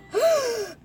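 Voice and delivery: loud high gasp after holding breath; big gasp for air. Real, not acted. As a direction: gulp in air, high and sharp. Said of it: "hold my breath challenge".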